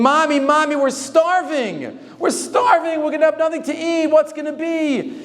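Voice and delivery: put-on high, wailing children begging their mother; crying kids. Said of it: "the kids screaming".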